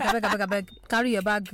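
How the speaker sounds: mock-impatient send-off, repeated plea; dismissive retort. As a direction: shoo them away, playfully exaggerated. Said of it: "More like".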